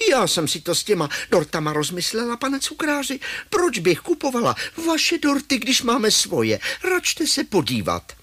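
high-pitched grandma, politely arguing; grandma's voice. Acted. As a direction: play a high-voiced grandma answering the shopkeeper. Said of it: "babičku, která drží druhý košík a říká". (vysoký hlas babičky)

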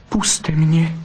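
high, pleading vixen asking to be let go. A narrator's reading. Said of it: "zaprosila Bystrouška".

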